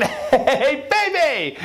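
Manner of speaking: very high, laughing hello; hammed-up elated husband, squealed high; big-smile greeting. A narrator's reading. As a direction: greet her giddily through a grin. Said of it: "had a big smile on his face".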